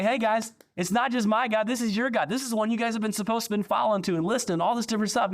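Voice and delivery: high, pleading prophet, half mock; exasperated Jeremiah. Pastor voices Jeremiah pleading with the stubborn people.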